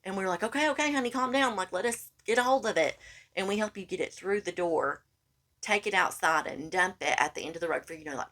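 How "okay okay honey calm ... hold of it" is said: higher, coaxing calm-down voice; soothing placating. Retold or imitated. Friend calming panicked Josh, high coaxing soothing.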